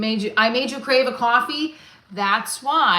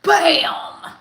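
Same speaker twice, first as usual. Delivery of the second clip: loud, high triumphant bam; shouted bam; 7 semitones higher, 8 dB louder than usual. Real, not acted. Showing viewers the pen, a triumphant shout. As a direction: punch it out like a reveal.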